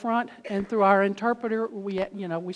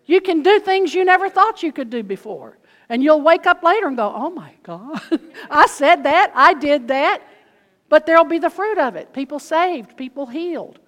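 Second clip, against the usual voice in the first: loud, high fervent preaching; 7 semitones higher, 10 dB louder than usual. Acted. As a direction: preach it with full fire. Preaching at full force.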